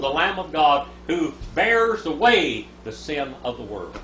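normal talking voice

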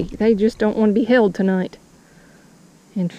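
normal talking voice

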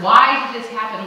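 loud, anguished "why"; half-yelled complaint at God; mock scream. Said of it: "screaming that word".

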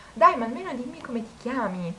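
lightly voiced pushy coaxing; a hint of a persistent flirt; imitating the insistent guy. Guy insisting on her name.

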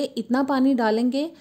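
ordinary talk, nothing marked

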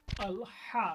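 হা said with a tight throaty h; Arabic throat h demo; pressed throat h. Shown as a demonstration. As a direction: squeeze the throat on হা. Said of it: "এই যে গলাটা চাপ হবে".

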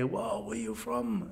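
high, soft, drawn-out hustler voice; Colombian street hustler. Retold or imitated. Local tout greeting gringo tourists, imitated hustler voice. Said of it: "we had a guy come up to us".

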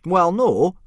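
owning up awkwardly; admitting. Acted. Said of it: "Gobber admitted".

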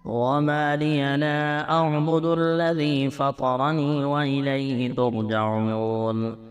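ordinary talk

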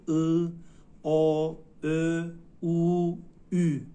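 loud, isolated Turkish vowels او, each held; vowel drill. Shown as a demonstration. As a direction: sound out each vowel او loudly and separately. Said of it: "خیلی دقت بکنین روی". (تمرین بلند و جداجدای صداهای «او»)

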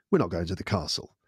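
low, matter-of-fact verdict; deadpan letdown. Retold or imitated. Husband to wife after reading dad's email, deadpan. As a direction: break the bad news plainly.

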